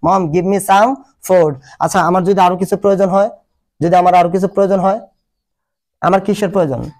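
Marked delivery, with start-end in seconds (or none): 0.1-1.5 very slow, each word apart; slow teaching drill. Shown as a demonstration. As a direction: say it slowly so the class can copy. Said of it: "কি বলবো আমরা".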